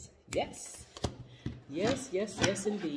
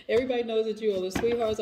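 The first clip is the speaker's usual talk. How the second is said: laughing; 5 semitones higher, 7 dB louder, faster than usual. Real, not acted. Laughing, teasing the viewers.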